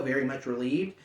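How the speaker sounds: ordinary delivery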